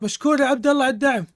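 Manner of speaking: usual speaking voice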